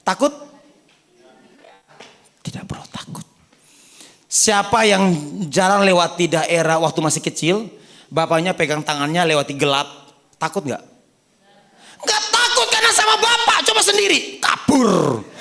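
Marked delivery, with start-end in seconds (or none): 2.4-3.2 whispered softly and comfortingly; reassuring whisper. Acted. 12.1-15.3 excited preaching. Acted.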